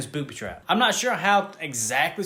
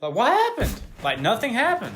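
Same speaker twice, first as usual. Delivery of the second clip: high, incredulous complaint; exasperated; 7 semitones higher, 3 dB louder than usual. Real, not acted. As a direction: question it in raised-pitch disbelief. Complaining the scene went nowhere.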